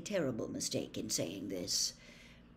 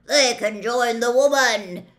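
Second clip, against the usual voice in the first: loud, raised centaur call; shouting; 6 semitones higher, 13 dB louder than usual. A narrator's reading. Dun-colored centaur urges punishment, loud shout. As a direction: shout the verdict to a crowd. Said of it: "a dun-colored centaur shouted".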